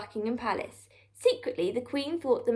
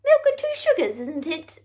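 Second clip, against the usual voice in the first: much higher, mock-polite; high mock-sweet royal voice; sweetly innocent Queen; 5 semitones higher, 4 dB louder than usual. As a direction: feign innocence after the tea spill. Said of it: "said the Queen".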